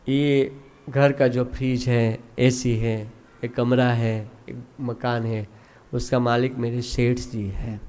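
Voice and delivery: low, soft, slowish reasoning; servant's calm explanation. Acted. Servant explains to everyone who owns the house, low and quiet. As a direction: explain humbly, quiet and measured. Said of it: "नौकर बहुत सुंदर अपने शब्दों में कहता है".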